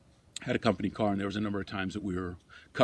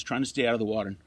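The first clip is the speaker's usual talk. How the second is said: ordinary talk, nothing marked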